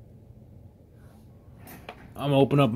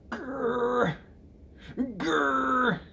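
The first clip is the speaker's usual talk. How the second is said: high squeaky play growl; mock growl; 12 semitones higher, 6 dB quieter than usual. Owner playfully growling at his pet, high squeaky growl.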